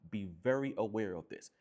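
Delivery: ordinary talk, nothing marked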